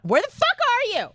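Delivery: high, exasperated shout into the phone; exasperated yell. Retold or imitated. To her lost dad at the airport, an exasperated high yell. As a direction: blurt it out at the top of your voice, fed up.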